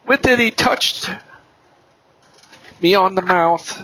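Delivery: high, slow, solemn Bible reading; measured, ringing recitation of a prophet's words; scripture reading. Acted. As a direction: declaim scripture slowly, pitch lifted, with long pauses. Reads Isaiah aloud at length.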